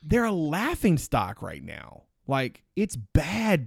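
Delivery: "laughing stock" and "bad" drawn out slowly, each word weighted; slow down and lean on "bad"; emphatic slow stress. Real, not acted. Stressing how bad Louisville is.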